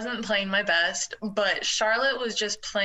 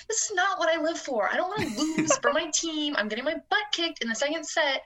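inner protest; 8 semitones higher than usual. Retold or imitated. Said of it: "in my head".

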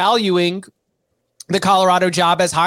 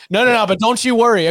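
mock reassurance; 5 semitones higher, faster than usual. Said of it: "it did sound hilarious".

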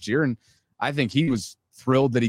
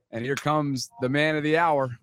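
announcer; 4 semitones higher than usual. Pretend. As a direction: hype the guest's entrance. Introduces guest like an announcer.

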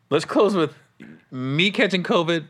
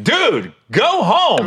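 slow, louder, drawn-out firm command; exasperated order; 6 semitones higher, 4 dB louder, much slower than usual. Retold or imitated. Friend telling the sick comic to go home, slow and firm. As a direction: send him home firmly. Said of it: "And I was like".

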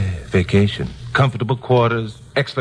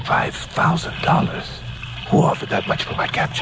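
parched whisper; 10 semitones higher, 5 dB quieter than usual. Acted. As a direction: gasp it out, too dry to voice. Stunned parched castaway.

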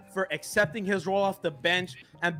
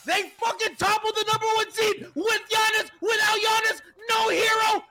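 ecstatic shouting rant; 7 semitones higher than usual. Real, not acted. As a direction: scream it at every doubter. Victory rant at doubters.

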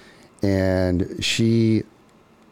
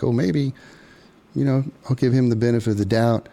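higher, quick second-guessing voice; doubting herself; 5 semitones higher, faster than usual. Retold or imitated. Woman talks herself into trusting him, high and quick. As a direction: talk yourself out of the fear, pitched up.

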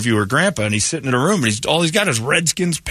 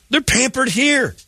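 indignant; 12 semitones higher, slower than usual. Real, not acted. Indignant retort.

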